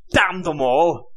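loud, high, slow outburst of rage; furious sputter. A narrator's reading. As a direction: spit the words out in fury. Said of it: "he sputtered".

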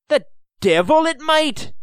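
high, loud indignant outburst; sputtering. A narrator's reading. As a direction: sputter it out in outrage. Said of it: "sputtered Owen".

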